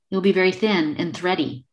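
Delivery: ordinary talk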